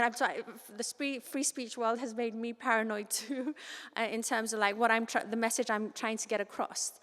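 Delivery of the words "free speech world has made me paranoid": ordinary talk, nothing marked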